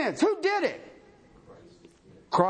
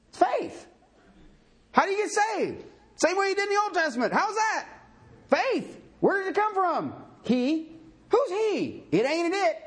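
fervent preaching; 13 semitones higher than usual. Acted. Preaching at full force.

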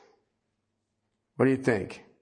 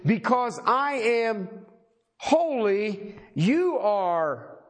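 very slow, drawn-out preaching with weight on each word; emphatic declaration; 7 semitones higher, much slower than usual. Acted. Preacher voicing God's word to his slaves, slow emphatic proclamation. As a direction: proclaim it slowly, pitch climbing as it goes on.

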